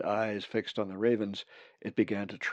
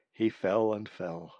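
plain reading voice

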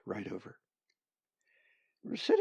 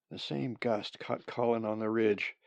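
ordinary delivery, nothing marked

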